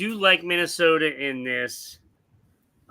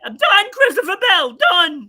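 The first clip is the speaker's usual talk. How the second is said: much higher, louder fed-up cry; exasperated outburst; 11 semitones higher, 8 dB louder than usual. Real, not acted. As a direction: throw your hands up at the driver. Fed-up fan dropping driver.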